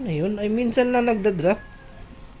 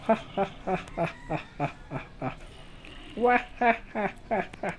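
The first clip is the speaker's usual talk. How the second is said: rhythmic "ha-ha-ha", trailing softer; staccato mock laugh; 7 dB quieter, faster than usual. Player showing off an attack technique, mock laughing. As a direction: punch out separate, fake chuckles. Fake laugh while fighting zombies.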